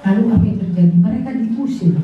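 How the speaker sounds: ordinary talk, nothing marked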